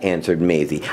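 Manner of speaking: ordinary delivery, nothing marked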